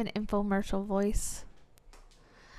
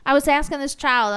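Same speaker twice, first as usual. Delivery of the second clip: ordinary delivery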